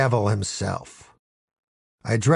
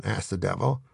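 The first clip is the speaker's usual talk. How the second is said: plain reading voice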